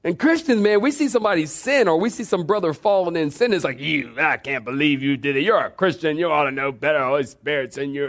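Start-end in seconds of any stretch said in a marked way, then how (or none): 3.8-8.1 a hint of a scolding church member, finger wagging; condemnation voice. Pastor mocks Christians scolding a fallen brother, lightly judgmental. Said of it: "That's my condemnation voice".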